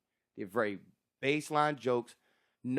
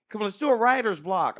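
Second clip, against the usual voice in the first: mocking fellow comic; 6 semitones higher, 8 dB louder than usual.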